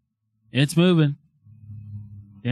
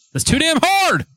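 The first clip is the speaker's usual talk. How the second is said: much higher, sharp outcry; sudden scolding yell. Real, not acted. Telling someone off for pressing the board, scolding yell. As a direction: yell it out in protest.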